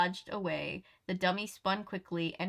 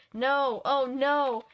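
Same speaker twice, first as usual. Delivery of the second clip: dismayed cry; 5 semitones higher, 4 dB louder, much slower than usual. A narrator's reading. Vase shatters, narrator despairs.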